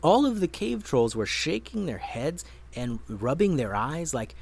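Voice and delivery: plain reading voice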